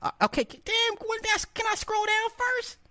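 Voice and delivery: high squeaky falsetto protest; flustered protest. Acted. Streamer protests while scrolling the chat, flustered squeal.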